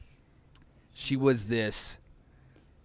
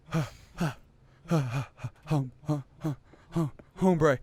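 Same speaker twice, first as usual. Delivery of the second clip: stuttering stuck sound; 3 semitones higher than usual. Retold or imitated. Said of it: "I legit go".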